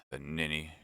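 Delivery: a soft, low murmur under the breath. A narrator's reading. Wife murmuring scornfully about her husband, quiet. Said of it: "murmured Madame Bonacieux".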